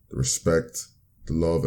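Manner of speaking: ordinary talk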